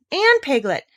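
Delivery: high, bright exclamation; delighted recognition; excited. Acted. Christopher Robin recognises Piglet, excited. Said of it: "said Christopher Robin excitedly".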